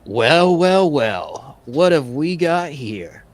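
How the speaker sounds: theatrical villain with swooping pitch; gang leader. Acted. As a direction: taunt them like a swaggering gang boss. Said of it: "he's like".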